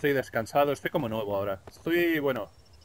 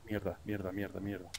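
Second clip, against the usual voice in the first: cursing under breath; 5 semitones lower, 8 dB quieter than usual. Real, not acted. Something goes wrong in game.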